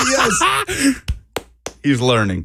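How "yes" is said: high burst of laughter; laughing. Real, not acted. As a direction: laugh it out in delight.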